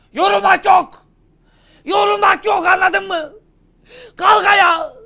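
loud, high drill-sergeant yell; coach shout. Acted. Teacher yelling at a student who says he's tired. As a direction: bark them back onto their feet.

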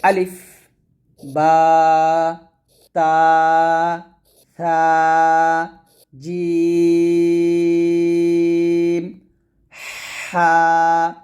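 each letter chanted with pauses, জিম long; recited letter line. Shown as a demonstration. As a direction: recite the row slowly for students. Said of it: "আমার সাথে একসাথে পড়েন".

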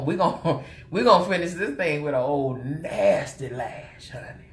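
ordinary delivery

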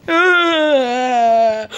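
long, drawn-out "ah" cry; sustained play-acted yell; pretend scream. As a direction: let out a long mock scream. Monster scaring the figures.

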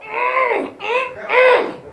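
high pitched mock moan of delight; mock revival hum. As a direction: hum it like you're caught up in church. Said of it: "He just, I'd get up and walk".

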